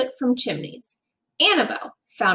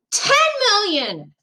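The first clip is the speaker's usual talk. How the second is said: higher, pushy bid; shout; 9 semitones higher than usual. A narrator's reading. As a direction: raise the offer with a shout. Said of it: "shouted the archduke".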